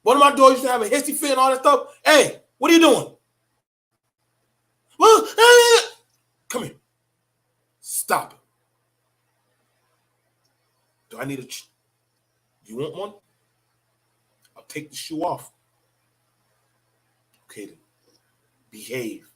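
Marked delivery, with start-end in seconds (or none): 2.0-3.2 sharp louder scolding snap; scolding call-out. Retold or imitated. Father retold snapping at his daughter's fit, loud. 5.0-5.8 much higher, louder whiny child imitation; whining child. Father imitates his daughter's tantrum, high whiny. 6.5-19.3 soft, low threatening parent voice; hushed stern warning. Retold or imitated. Father softly threatens his daughter to behave. Quietly disciplining a child.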